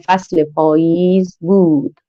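each word drawn out slowly for the class; slow dictation reading. Shown as a demonstration. Teacher slowly dictating a sentence to pupils, drawn out. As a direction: read it slowly like dictating to first graders.

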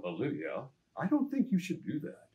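lightly hammed Batman-style line reading; Adam West impression. Actor's dubbed line in the final cut, lightly voiced Adam West. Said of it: "You do a great Adam West impression".

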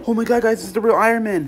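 high, very loud panic; panicked shouting. Acted. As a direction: shout to viewers in sheer alarm. Intruder suddenly appears.

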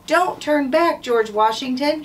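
high, loud, urgent cry; shout. A narrator's reading. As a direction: call it out to the general. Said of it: "she shouted".

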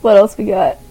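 usual speaking voice

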